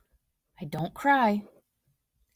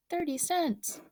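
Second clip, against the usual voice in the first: 1 excited; 10 semitones higher, 3 dB quieter, slower than usual. Acted. Boy excited about his dimes.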